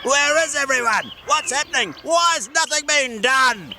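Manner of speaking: high, furious gnome questions; angry gnome. Acted. Gordon furious, nothing done.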